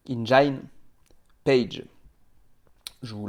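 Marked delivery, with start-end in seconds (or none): 0.1-1.8 "Engine" said again with a French accent, a little firmer; French-accented English correction; English pronunciation retried. Shown as a demonstration. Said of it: "avec mon accent anglais, c'est pas terrible".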